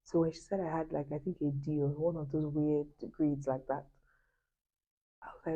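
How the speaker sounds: usual speaking voice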